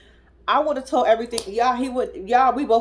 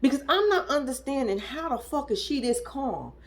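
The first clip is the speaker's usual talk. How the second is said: normal talking voice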